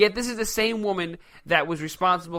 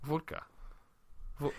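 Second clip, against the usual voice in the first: quiet mock Russian accent; 3 semitones lower, 15 dB quieter than usual. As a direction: softly echo it in a put-on Russian voice. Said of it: "Sean Connery with a Russian accent".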